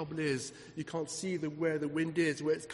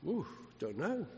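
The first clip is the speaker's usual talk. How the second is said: imagined flustered reply; 10 dB quieter than usual. Retold or imitated. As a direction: voice the listener's unsure answer very softly. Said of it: "And you say to me".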